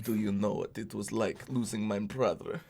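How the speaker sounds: soft, low fake Slavic accent as Wanda; mock Wanda accent. Streamer mocking Wanda's film line in a fake Slavic accent. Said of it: "Wanda doesn't even have an accent".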